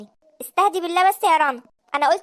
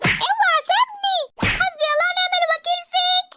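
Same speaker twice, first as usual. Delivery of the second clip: frightened scream; 12 semitones higher than usual. Acted. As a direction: cry out as he grabs you. Husband grabs wife, she screams. (صراخ مذعور عالٍ وبطيء)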